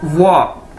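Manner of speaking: वाह said slowly and clearly as a Chinese third tone, low and dipping; teacher models वाह low, with no trailing o; Chinese word wǒ. Shown as a demonstration. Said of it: "तो उसकी pronunciation क्या है?". (वाह को धीमे और नीचे सुर में, साफ़ साफ़ बोलें)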